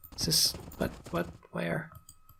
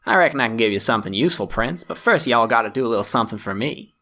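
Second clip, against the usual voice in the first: a light Texan twang for Walker the ranger; forest ranger's Southern drawl. Acted. Walker the forest ranger greeting the prince, light Southern accent. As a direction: lean into a folksy Southern accent, played lightly. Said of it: "Accents are a weakness of mine".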